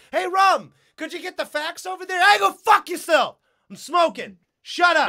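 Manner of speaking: high, loud, comically irate coworker; mock office yelling. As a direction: yell a rude brush-off across the office. Acted office quarrel.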